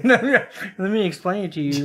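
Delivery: slow, laughing, talking down; slow patronizing tone with a laugh; mock condescension. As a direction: explain as if to someone hopeless, breaking into laughter. Acting condescending explanation at work.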